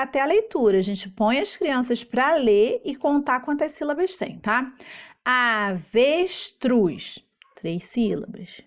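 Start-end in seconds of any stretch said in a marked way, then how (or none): 5.2-7.2 "Avestruz" very slowly as a-ves-truz; three stretched beats in "Avestruz"; one syllable at a time. Shown as a demonstration. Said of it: "pronunciando uma sílaba de cada vez".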